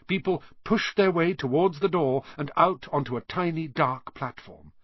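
ordinary delivery, nothing marked

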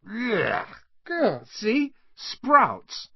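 high-pitched cries of revulsion; yucky, exclaimed in high disgust. A narrator's reading. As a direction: recoil from a horrible taste. Boy bites a nasty bean.

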